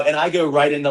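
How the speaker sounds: normal talking voice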